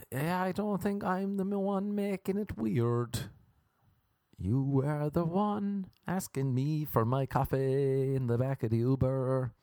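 high, stretched-out mock indignation; drawn-out retort. Passenger answering the Uber driver, high drawn-out retort. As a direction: drag the words out in a high voice.

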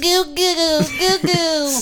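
put-on high cooing baby voice; baby talk. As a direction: coo like a baby.

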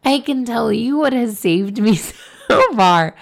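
high, loopy frog-puppet voice breaking into laughter; Kermit the Frog. Acted. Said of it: "do it in a Kermit voice".

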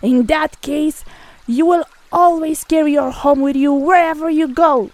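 narrator's acted shout of fury; furious shout. A narrator's reading. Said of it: "He shouted in a voice so loud".

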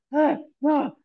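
high, gasping imitation; out of breath. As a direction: gasp as if badly winded. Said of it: "I'm, like, out of breath".

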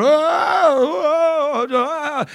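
imitated moaning. Preacher imitating the lady who wept every service, exaggerated moaning. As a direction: imitate a woman moaning and crying in the corner. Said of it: "crying, moaning".

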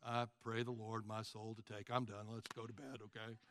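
low, soft monotone bedtime prayer; no emotion. Acted. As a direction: drone it out, tailing off toward bed. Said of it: "There's no emotion".

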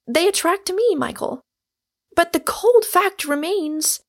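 high-pitched, emphatic pleading; earnest young woman. Acted. Rosamond warning Michael against imprudent marriage, earnestly. Character's long anxious reply in novel.

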